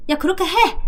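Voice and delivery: high, sharp order 그렇게 해; bossy command. Retold or imitated. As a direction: snap it out like giving an order. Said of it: "하고 명령을 했어요". (높은 음으로 명령하듯이)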